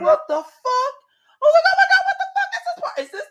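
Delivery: high, loud, rushed panic with laughter; retold panic. Rider reliving the dip on the slide, panicked. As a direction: race through it high, as if about to drop.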